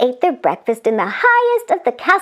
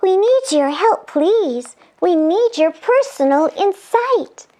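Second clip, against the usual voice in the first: put-on raised girlish voice; pleading angel; 7 semitones higher than usual. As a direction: ask nicely.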